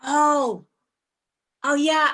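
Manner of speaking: ordinary talk, nothing marked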